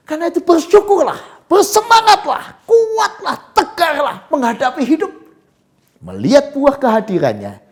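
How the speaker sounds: high, loud preaching at full force; soaring pulpit voice, proclaiming; fiery preaching. Acted. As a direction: preach it out with rising fire. Exhorting congregation.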